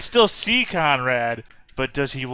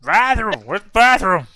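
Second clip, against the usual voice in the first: put-on high, loud kid voice; desperate kid; 7 semitones higher, 6 dB louder than usual. Kid pushing past the worker, loud, high and desperate. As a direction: whine like a kid who must pee.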